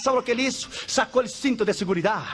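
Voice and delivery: ordinary talk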